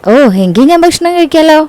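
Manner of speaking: put-on high-pitched, happy child's reply; delighted agreement. Child delighted by father's promise. (സന്തോഷത്തോടെ ഉയർന്ന സ്വരത്തിൽ സമ്മതിക്കുന്നു)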